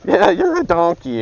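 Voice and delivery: high, loud clown voice. Acted. Clown mocking the man before a laughing crowd, loud taunt. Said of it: "And the clown says".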